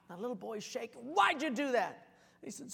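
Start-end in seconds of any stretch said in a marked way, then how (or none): 1.1-2.1 loud, high demand for an answer; angry yelling. Acted. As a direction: bellow the accusation at a child. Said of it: "starts yelling at this kid".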